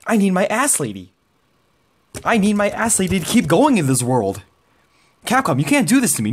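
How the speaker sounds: high, fast desperate whining; whiny plea. Real, not acted. Begging Capcom for Cammy.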